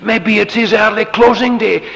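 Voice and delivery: loud sarcastic taunt; mocking taunt. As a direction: jeer at the false prophets. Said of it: "It was the sarcasm of it".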